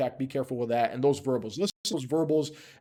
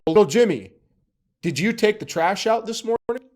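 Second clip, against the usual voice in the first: raised-pitch mock parent query; parent questioning child; 7 semitones higher, 5 dB louder than usual. Parent to little Jimmy about the trash, mock questioning. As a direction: put it pointedly to a child.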